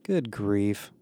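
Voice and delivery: soft, weary dismissal; sighing. A narrator's reading. Barrett reacts to Emma's vanity, sighing. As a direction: let it out as a quiet sigh. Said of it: "Barrett sighed".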